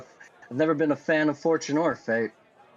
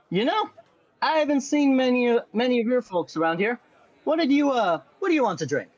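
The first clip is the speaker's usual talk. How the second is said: bartender voice; 7 semitones higher, faster than usual. Acted. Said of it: "and goes".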